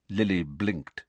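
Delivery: ordinary talk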